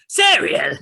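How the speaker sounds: high, very loud shout of a name; full-volume in-character holler. Acted. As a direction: yell it at the top of your voice. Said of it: "Full volume".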